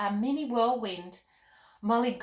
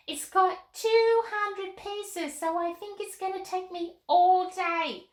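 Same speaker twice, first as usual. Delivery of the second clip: put-on much higher, bubbly young girl; little girl Molly voice; 10 semitones higher, 3 dB louder than usual. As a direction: chirp like an excited little girl puppet. Puppet Molly answers Carmen.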